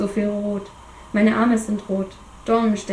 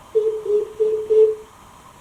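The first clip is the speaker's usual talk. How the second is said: beeping; 12 semitones higher, much slower than usual. Acted. Imitating a monitor beep.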